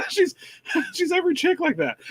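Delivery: high laughing gossip; laughing rant. Real, not acted. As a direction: laugh through the complaint. Laughing rant about character.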